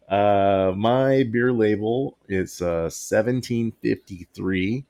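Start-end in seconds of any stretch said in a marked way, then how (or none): none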